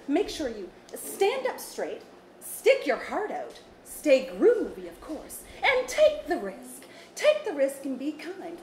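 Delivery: slow, high, upbeat auntie advice. Acted. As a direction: coax the kids, slow and bouncy. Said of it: "In your Auntie B voice?".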